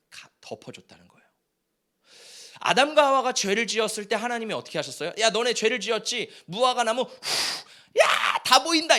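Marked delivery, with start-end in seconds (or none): 5.2-9.0 hammed-up taunt ending in a louder, higher shout; mock scolding God. Preacher imagining God taunting Adam and Eve, mock shout. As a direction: jeer at the sinners as a pretend God. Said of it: "이렇게 하셨어요?".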